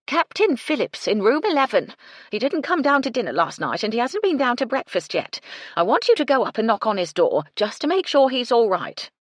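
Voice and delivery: loud call over clattering pans; yelled over the din. A narrator's reading. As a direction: shout across a noisy kitchen. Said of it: "she yelled at Gertie".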